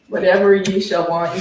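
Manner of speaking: usual speaking voice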